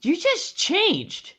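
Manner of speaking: high, slow astonishment; stunned disbelief; surprised. Real, not acted. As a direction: drag out the shock. Shocked at outfit change.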